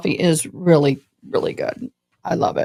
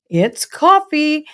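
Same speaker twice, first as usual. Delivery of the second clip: excited reveal; 6 semitones higher, slower than usual. Pretend. Sponsor reveal.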